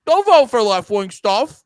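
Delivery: loud, high, goofy mock voice; mocking imitation. Voicing what the other side assumes, loud mockery. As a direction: parody a dim-witted political assumption.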